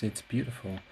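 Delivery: ordinary delivery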